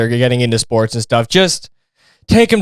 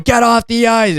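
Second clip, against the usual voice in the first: high-pitched imitation of the ref; mock ref order; 9 semitones higher than usual. Ref ordering the mom off the ice, mock call. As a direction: bark it like the ref, lightly. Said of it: "the ref just going".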